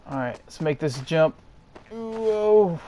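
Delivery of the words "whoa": surprised. Real, not acted. Risky jump in game.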